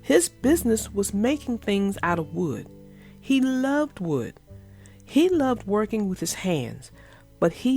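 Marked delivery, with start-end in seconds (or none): none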